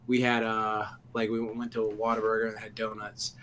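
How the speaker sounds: ordinary talk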